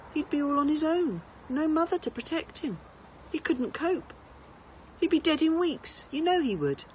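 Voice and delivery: mother trying to comfort her son; mother's voice. A narrator's reading. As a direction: coax a grieving child. Mother comforts crying Bertie.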